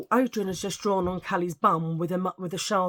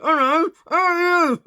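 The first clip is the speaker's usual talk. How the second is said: much higher, louder, slow strangled dummy voice; ventriloquist voice; 10 semitones higher, 11 dB louder, slower than usual. Shown as a demonstration. Showing the listeners her ventriloquist trick in a put-on dummy voice. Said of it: "I could have been a ventriloquist".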